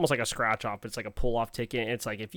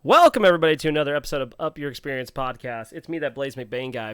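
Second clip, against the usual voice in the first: podcast host intro; 2 semitones higher, 9 dB louder than usual. Acted. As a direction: announce the show with energy. Opens the podcast episode.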